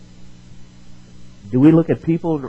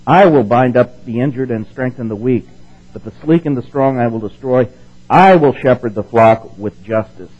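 louder, weighty declaration; scripture proclamation; 8 dB louder than usual. Acted. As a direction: preach the promise with force. Reading God's words in Ezekiel.

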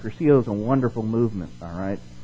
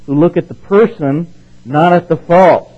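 much higher, louder, slow on fault; emphatic preaching; 7 semitones higher, 10 dB louder than usual. Acted. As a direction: hammer home the word fault. Preaching the key contrast.

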